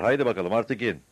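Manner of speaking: shouted urging. A narrator's reading. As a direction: hurry someone down, loudly. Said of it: "diye bağırdılar".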